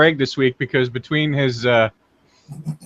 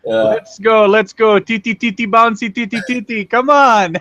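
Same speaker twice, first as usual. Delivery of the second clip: higher, louder Russian director egging on; Russian director shouting; 6 semitones higher, 3 dB louder than usual. Pretend. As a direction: coax the actors in a hammy Russian accent. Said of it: "Russian softcore porno director".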